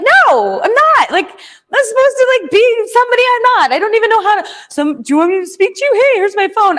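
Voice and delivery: high, frantic rant; going off. Retold or imitated. To neighbor Tyson, ranting when asked if she's okay, high and frantic. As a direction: pour it all out in a high, frantic rush. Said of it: "I was just going off".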